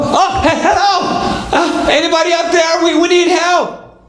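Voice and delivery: very high, urgent cry for help; acted excited calling. Family to man walking through, excited high cry for help. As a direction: stumble into an eager, high-pitched shout back. Family calls back to passerby.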